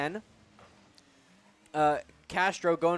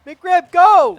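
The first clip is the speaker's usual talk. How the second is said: cheering shout; 13 semitones higher, 17 dB louder than usual. Real, not acted. Cheering player toward goal.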